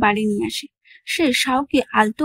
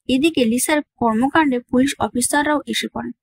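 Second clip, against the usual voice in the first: plain reading voice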